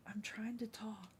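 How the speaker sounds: hushed whisper to the cat. Real, not acted. Scolding her cat under her breath, whispering. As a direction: whisper it so the chat barely hears. Hushing the cat mid-stream.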